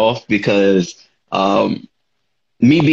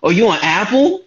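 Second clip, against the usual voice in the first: excited fan quote; 8 semitones higher than usual. Retold or imitated. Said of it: "they be like".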